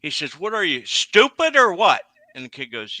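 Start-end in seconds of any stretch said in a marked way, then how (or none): 0.4-2.0 louder, higher exasperated bark; imitating a boss losing patience; mock angry outburst. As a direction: chew the kid out in disbelief. Scolding driver in tower.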